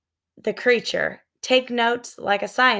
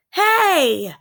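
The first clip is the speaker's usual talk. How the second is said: loud higher warning cry; shout; 9 semitones higher, 4 dB louder than usual. A narrator's reading. Jack shouting as Annie climbs down the ladder. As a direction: stop her with a shout. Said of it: "shouted Jack".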